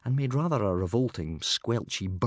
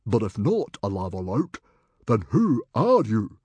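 puzzled demand; 2 semitones higher, 6 dB louder than usual. A narrator's reading. Stoic questions the unmasked stranger, puzzled. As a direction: press him for his name.

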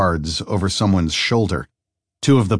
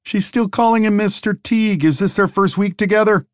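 mocking lumberjack; 12 semitones higher than usual. Lumberjack mocks Harry's formality.

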